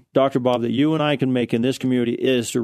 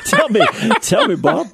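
eager laughing plea, much higher; 4 dB louder than usual. Real, not acted. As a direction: beg playfully for the answer while laughing.

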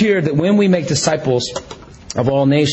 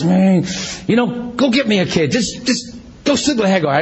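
higher-pitched, exasperated mock complaint; Sarah, frustrated; 5 semitones higher than usual. Frustrated wife telling her husband to have a child, mock exasperation. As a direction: impatiently push the demand, lightly acted. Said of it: "Sarah's getting frustrated".

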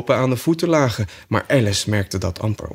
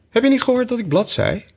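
high, cross sheep voice; angry sheep; 13 semitones higher, faster than usual. Acted. Sheep scolds Alice for not listening, high and cross. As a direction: snap at her like an annoyed sheep. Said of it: "riep het schaap boos".

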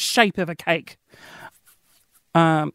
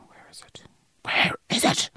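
unvoiced whisper to oneself; whispered self-talk; 8 dB quieter than usual. Real, not acted. Podcaster searching for a song to play, whispering to self. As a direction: whisper it under your breath while hunting for something.

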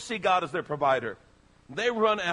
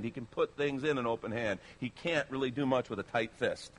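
usual speaking voice

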